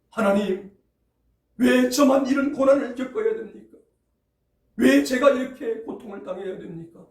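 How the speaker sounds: loud, high, aggrieved protest; complaining to God. Retold or imitated. An ordinary believer loudly complaining to God about suffering. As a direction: cry out in grievance at God. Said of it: "원망과 불평을 쏟아내는 것이".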